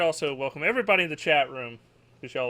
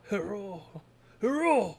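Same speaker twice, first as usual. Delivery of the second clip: silly reply voice; 5 semitones higher than usual. Retold or imitated. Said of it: "I always do it".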